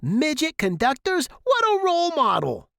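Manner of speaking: very high, outraged shouting; irate dad yelling in disbelief; screaming father. A narrator's reading. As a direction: explode with sarcastic fury. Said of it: "my father screamed".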